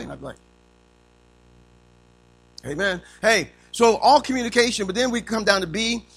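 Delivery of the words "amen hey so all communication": usual speaking voice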